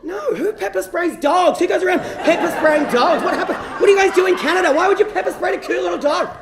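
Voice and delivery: high-pitched, disbelieving protest; incredulous rant. Retold or imitated. Traveller arguing about pepper spraying dogs, high and incredulous.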